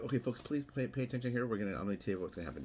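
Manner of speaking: fast, lifted PA-style folks-please read; mock formal announcement. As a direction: play the polite set announcer, a bit hammed-up. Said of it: "it wasn't like anything formal".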